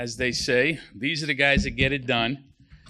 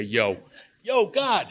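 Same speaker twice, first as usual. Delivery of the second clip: high, louder Yo yo God, hollered upward; hammed-up contractor hail with Yo stretched out; contractor talk; 4 semitones higher, 3 dB louder, much slower than usual. Shown as a demonstration. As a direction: yell it up like a site foreman. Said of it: "That's how contractors talk".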